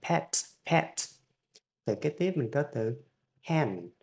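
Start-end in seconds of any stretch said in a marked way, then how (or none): 0.1-0.9 "Pet" with a strong puff of air on the p; teacher demo of an aspirated p in "pet". Shown as a demonstration. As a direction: blow air hard on the p of "pet". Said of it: "pờ, bật hơi ra cho thầy nha".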